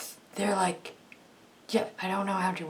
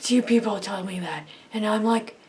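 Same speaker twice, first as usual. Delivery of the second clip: usual speaking voice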